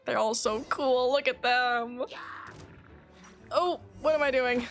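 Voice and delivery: normal talking voice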